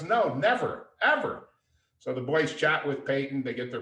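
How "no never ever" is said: retold emphatic denial. Star's retold amused denial.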